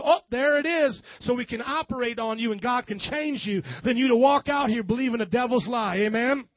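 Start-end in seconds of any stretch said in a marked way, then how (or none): 0.0-1.0 high, loud "oh" of discovery; acted doctor spotting the problem; doctor's discovery. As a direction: exclaim as if you just found it. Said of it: "like a doctor poking around and go".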